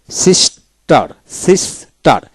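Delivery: সিস্টার split as সিস, then টার; teacher sounding out সিস্টার with a gap before টার; syllable by syllable. Shown as a demonstration. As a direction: say সিস্টার in two separate beats. Word read out split into syllables.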